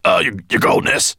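loud, stammering croak; frightened henchman stutter. A narrator's reading. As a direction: croak it out in alarm. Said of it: "he croaked".

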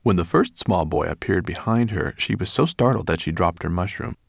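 usual speaking voice

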